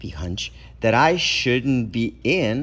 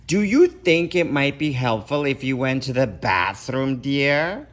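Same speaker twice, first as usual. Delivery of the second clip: loud and clear; 4 semitones higher, 4 dB louder than usual. Acted. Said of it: "asked me loud and clear".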